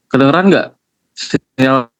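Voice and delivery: normal talking voice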